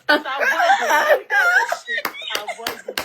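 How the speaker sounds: high, slow, laughing "avoid it"; mocking mother's English. Mimicking mom's misused word at the meeting, high and slow. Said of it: "She now say".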